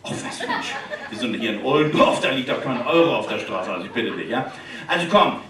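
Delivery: usual speaking voice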